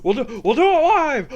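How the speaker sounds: high, loud overwrought newsman; Bill O'Reilly. Acted. Comedian spoofs a TV host climaxing, Bill O'Reilly impression. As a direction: push the voice up high and strained. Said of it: "All right, that's my Bill O'Reilly".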